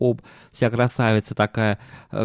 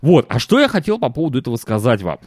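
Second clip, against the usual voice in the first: normal talking voice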